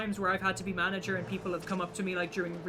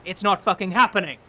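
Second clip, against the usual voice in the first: firm manager; 10 dB louder than usual. Retold or imitated. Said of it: "She went".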